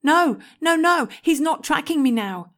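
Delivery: high, loud desperate pleading; desperate cry. A narrator's reading. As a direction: cry out in panic for her mother. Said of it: "I cried desperately".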